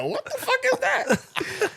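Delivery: very high, slow, drawn-out disbelief; laughing mock bewilderment; baffled. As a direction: stretch it out in comic confusion. Retelling his baffled reaction.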